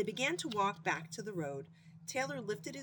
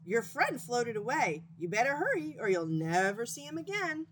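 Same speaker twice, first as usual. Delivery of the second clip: frog warning; 4 semitones higher, 4 dB louder than usual. Acted. Frog warns skunk.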